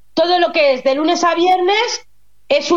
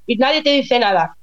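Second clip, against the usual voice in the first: ordinary delivery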